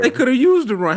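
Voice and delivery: high, dry sarcastic jab. Real, not acted. Sarcastic quip.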